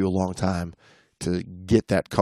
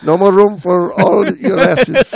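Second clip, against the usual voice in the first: higher, louder hammed-up Mexican accent; mock Mexican accent; 10 semitones higher, 9 dB louder than usual. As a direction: play the dive master in a mock Mexican accent. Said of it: "Mexican accent".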